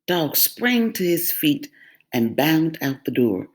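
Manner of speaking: plain reading voice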